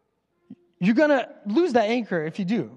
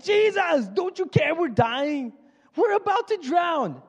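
much higher, panicked cry for help; panicked disciples; 8 semitones higher, slower than usual. Retold or imitated. Disciples begging a sleeping Jesus, high panicked plea. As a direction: plead like frightened men in a sinking boat. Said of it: "the disciples run to Jesus like".

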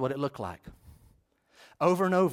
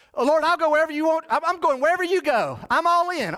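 high, loud, gushing pledge; eager follower; 10 semitones higher, 4 dB louder than usual. Acted. Would-be follower pledging to the Lord, high and eager.

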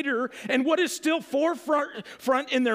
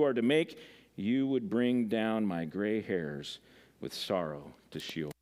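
steady, measured Bible reading, lower than his chat; scripture reading; 7 semitones lower than usual. Acted. Preacher reads Genesis to the congregation, measured scripture reading. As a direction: read the passage out to the congregation, unhurried.

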